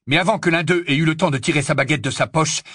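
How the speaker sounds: usual speaking voice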